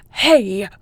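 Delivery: loud protesting yell; indignant shout. Acted. Patient tricked by the countdown, loud indignant shout.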